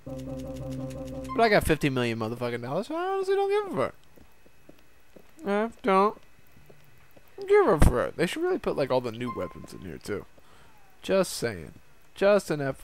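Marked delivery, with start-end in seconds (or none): 2.9-3.9 mock squeaky voice. Squeaky careless brag. 5.4-8.1 defiant repeat. Pretend. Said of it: "Still talking like this".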